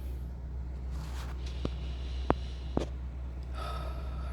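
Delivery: a long, breathy, drawn-out sigh; long heavy sigh. Real, not acted. Worn-out trader at a hard point, long heavy sigh. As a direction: let all the weight out in one breath.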